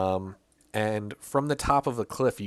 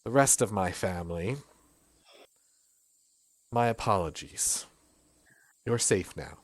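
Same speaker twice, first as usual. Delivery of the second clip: gentle apology; 3 semitones lower, 7 dB quieter than usual. Acted. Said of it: "She looks down and says".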